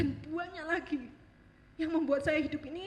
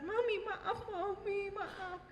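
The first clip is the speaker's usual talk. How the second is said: high, crying plea to mother; tearful apology; 7 semitones higher, much slower than usual. Retold or imitated. To her mother, a tearful high plea. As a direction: beg forgiveness through tears.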